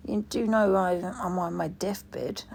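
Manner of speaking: ordinary delivery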